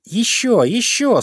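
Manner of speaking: high, urgent command. A narrator's reading. Pufik orders bear to push harder, commanding. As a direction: urge him on in a high voice. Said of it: "скомандовал Пуфик".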